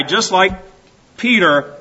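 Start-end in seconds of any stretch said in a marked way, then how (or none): none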